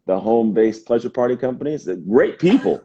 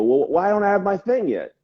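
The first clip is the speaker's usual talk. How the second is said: higher, whiny mock question; frustrated client; 10 semitones higher than usual. Clients who hit their limit, mock-whiny and higher. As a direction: imitate a client whining about results. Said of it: "they go".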